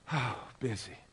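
soft, sighing "busy" after a heavy breath; weary sigh. Retold or imitated. Pastor voicing a worn-out soul, soft and weary. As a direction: let "busy" sag out on a tired exhale. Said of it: "we actually do get out of breath".

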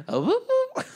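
very high sung whoop whoop; squeaky mock whistle call; whistle imitation. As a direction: mimic a broken whistle.

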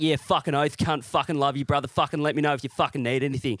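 mock bogan mate pledging support; exaggerated Australian swearing mateship; broad Australian accent. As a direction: rattle it off as a loyal Aussie lout. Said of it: "think of the Australian accent".

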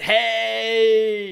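very high, loud, drawn-out "hey"; exaggerated gloating greeting; mock smug. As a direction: crow it smugly, way up high. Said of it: "You went".

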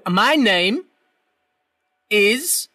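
slow, spaced-out words; to someone hard of hearing. Acted. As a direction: draw each word out for a deaf listener. Repeating slowly for deaf man.

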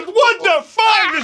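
high, very slow startled cry; drawn-out shocked man; mock startled man; startled exclamation. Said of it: "Il a fait".

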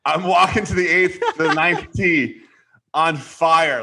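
usual speaking voice

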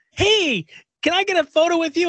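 high-pitched eager fan call; mock over-eager photo request; pushy fan. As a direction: pipe up like an intrusive fan. Acting out an intrusive fan.

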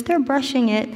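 ordinary talk, nothing marked

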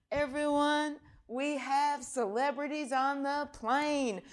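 high, loud, drawn-out PA announcement; hammed-up cabin announcement; flight attendant announcement. Senator mimicking flight attendant on the plane mic, high and slow. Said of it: "the flight attendant takes the microphone".

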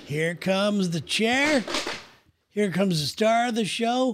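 tongue-in-cheek emcee build-up; mock show announcer. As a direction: announce the star with big, slow fanfare. Mock introduction of co-host.

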